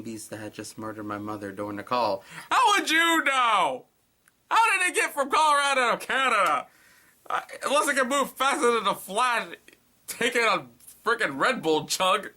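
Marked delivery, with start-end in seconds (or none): 2.5-12.3 mocking shouted complaint; incredulous shouting. Real, not acted. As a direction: yell at the plot hole. Mocking story logic.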